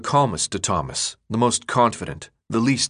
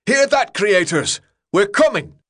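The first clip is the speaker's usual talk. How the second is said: yelled battle cry; 10 semitones higher, 5 dB louder than usual. A narrator's reading. Newt yells at the creators as the charge begins, defiant battle cry. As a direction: shout a challenge out to the distant enemy. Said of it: "thrust his weapon into the air and yelled".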